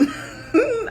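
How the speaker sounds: sudden high laugh at her own joke; high burst of laughter. Real, not acted.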